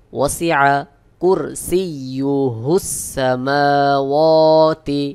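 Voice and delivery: slow chanted recitation; teacher models the verse in slow tajweed; Quranic recitation. Shown as a demonstration. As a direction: recite it slowly and melodiously.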